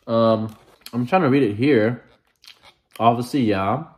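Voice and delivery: words muffled by food; talking while chewing. Real, not acted. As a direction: mouth partly full of hot food. Seen 2: bites at -5, fans mouth, lips keep working while talking.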